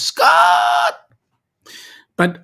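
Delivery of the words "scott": very high, loud drawn-out holler; imitated shout. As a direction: imitate a sound clip yelling a name. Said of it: "that goes".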